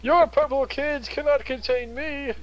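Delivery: high, gleeful taunting voice; defiant villain. Pretend. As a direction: crow in triumph at them. Token taunting the squares.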